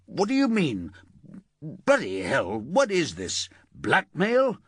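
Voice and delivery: high, shocked, stumbling denial; a man blindsided by an arrest, spluttering. Acted. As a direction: splutter it out, caught off guard. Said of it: "he started spluttering".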